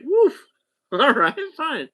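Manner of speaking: a startled laugh that can't quite believe it; laughing, stunned reply. Retold or imitated. Laughing high and loud at the host's remark about shoes. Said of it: "I was like".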